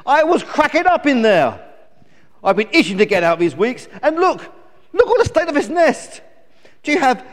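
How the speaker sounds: put-on high, loud bratty baby chick; stroppy high-pitched grumbling; indignant complaining. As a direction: rant at your parents, demanding and put out. Newborn chick scolds its parents.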